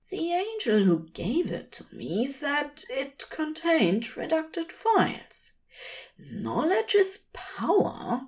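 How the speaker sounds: a nervous, prim angel half-whispering his suspicion; fussy angel voice. Acted.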